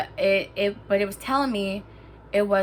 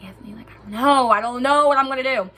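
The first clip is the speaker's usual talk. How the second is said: raised voice, retold desperation; exasperated panic; 3 semitones higher, 6 dB louder than usual. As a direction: act out being at a loss with a brother. Acted panicked answer to brother.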